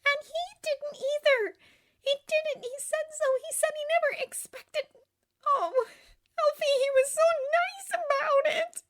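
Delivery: high-pitched weepy lament; tearful. A narrator's reading. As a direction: whine it through tears. Tearful friend pours out her misery.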